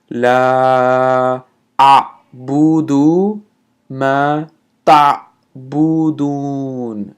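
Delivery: لا أعبد ما تعبدون split with pauses; broken up slowly. Shown as a demonstration. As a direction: say it word by word, slowly. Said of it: "let me break that up slowly".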